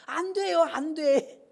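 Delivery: put-on high, pleading girl's voice; girl's protest. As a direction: act the student whining it won't work. (울먹이는 여학생 흉내)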